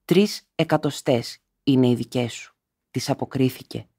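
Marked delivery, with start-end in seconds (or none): none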